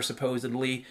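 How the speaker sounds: ordinary talk, nothing marked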